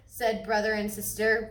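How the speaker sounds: ordinary delivery, nothing marked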